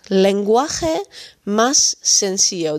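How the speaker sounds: "Lenguaje más sencillo" spoken slowly, every syllable clear; slow model phrase. Shown as a demonstration. Spanish teacher to learners, slow careful model. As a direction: let the learner hear each syllable. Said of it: "Lenguaje is taal of taalgebruik".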